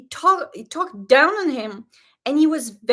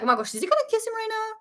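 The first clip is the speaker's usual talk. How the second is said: high, fast gasping disbelief; squealing at the screen, high and fast; excited disbelief; 10 semitones higher, much faster than usual. Retold or imitated. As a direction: rattle it off in shocked excitement. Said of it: "I was like".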